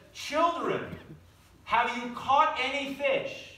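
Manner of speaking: lightly acted shout to the boat; mock call out. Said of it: "he yells out to them".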